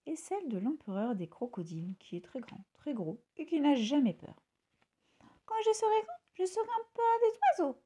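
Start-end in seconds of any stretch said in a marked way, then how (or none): 5.5-7.8 put-on high, bright child voice; small bird dreaming big, pitched high; little bird César. A narrator's reading. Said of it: "dit César".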